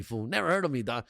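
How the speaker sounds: normal talking voice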